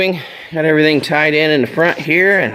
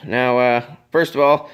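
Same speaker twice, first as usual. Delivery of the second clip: ordinary talk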